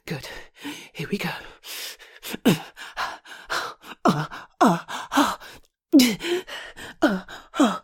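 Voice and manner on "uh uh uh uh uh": short panting moans; breathy moans in spaced bursts; rhythmic moaning. Acted. Nurse with patient in adult roleplay, panting moans.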